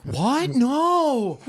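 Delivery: hammed-up disbelief; mock shock. Shocked son hears news.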